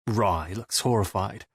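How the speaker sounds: usual speaking voice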